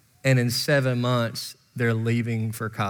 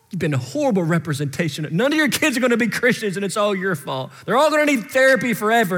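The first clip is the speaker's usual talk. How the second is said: higher, fast tirade of blame; accusing voice; 7 semitones higher, 3 dB louder, faster than usual. Retold or imitated. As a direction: pile up accusations, rapid and high-pitched. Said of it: "hearing that voice".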